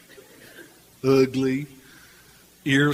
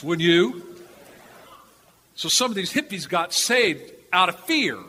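normal talking voice

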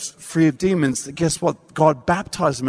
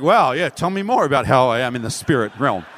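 mock self-satisfied; 6 semitones lower than usual. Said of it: "I'm feeling so amazing about who I am".

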